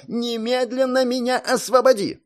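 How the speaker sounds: high, demanding yell; shouting. A narrator's reading. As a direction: scream the order at him. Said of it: "закричала".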